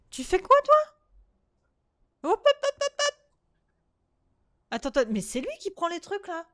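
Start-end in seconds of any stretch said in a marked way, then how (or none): 0.1-3.1 high-pitched shout. Real, not acted. Yelling at the game child.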